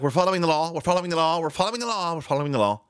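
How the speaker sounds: mock press secretary. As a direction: repeat it like a mocked spokesperson. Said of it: "she's like".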